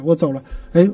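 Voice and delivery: normal talking voice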